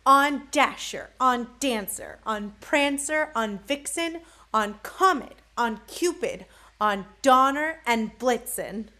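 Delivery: loud, punchy roll call. Acted. Saint Nick summoning his reindeer by name, calling out. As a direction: call out each name like a command.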